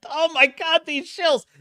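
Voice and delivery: ordinary delivery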